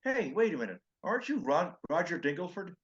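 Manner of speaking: excited fan fumbling the name; mock starstruck stranger; imitating the fan. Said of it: "Guy goes".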